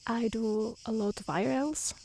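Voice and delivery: ordinary talk, nothing marked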